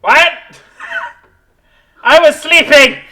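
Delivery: loud, high cranky skull; grumpy skull voice. Acted. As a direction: shout like a rudely woken skull. GM voices skull woken from sleep.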